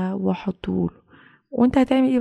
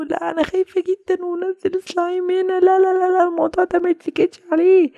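loud, higher panicked no; refusing to stand for it any longer; mother panicking about the monster; alarmed outburst; 9 semitones higher, 3 dB louder than usual. Acted.

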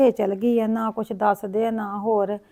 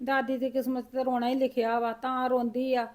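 much softer, tearful resignation; tearful grandmother; 13 dB quieter than usual. Acted. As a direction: weep quietly as you send the boy home. Grandmother weeping over lost daughter. (ਰੋਂਦੀ ਹੋਈ ਹੌਲੀ ਦਾਦੀ)

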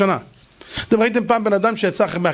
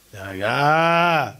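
very slow low soothing word; calm; 4 semitones lower, much slower than usual. Acted. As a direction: stretch it out, perfectly calm. Elisha calms again.

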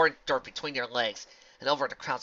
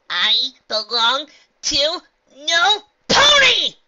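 slow, high, very loud cry of defiance; defiant scream; 18 dB louder, much slower than usual. Acted. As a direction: scream it out, breaking free. Possessed pony cries out.

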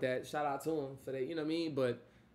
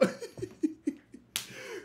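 high, wheezy burst of laughter; laughing; 12 semitones higher than usual. Real, not acted. Host catching himself getting carried away, high laughing. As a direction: crack up at yourself.

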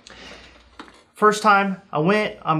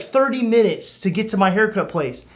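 ordinary talk, nothing marked

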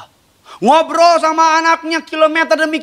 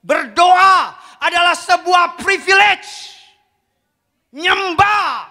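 emphatic preaching; 6 semitones higher, much slower than usual. Real, not acted. Preaching at full force.

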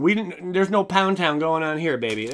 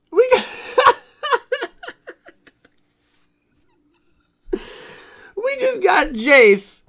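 real, very high, loud, slow, voice breaking into tears; so happy you can barely form the words; overwhelmed crying joy; 13 semitones higher, 9 dB louder, slower than usual. Real, not acted. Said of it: "I can't even talk".

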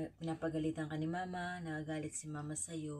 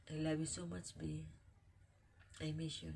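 ordinary talk, nothing marked

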